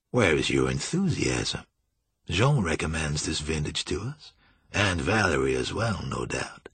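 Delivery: low, softened voice of a sly master; sly, urbane Julian. A narrator's reading.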